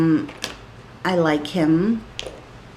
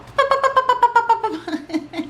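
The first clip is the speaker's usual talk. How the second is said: squealing high giggle; flustered high giggle. Real, not acted. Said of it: "makes me even more like".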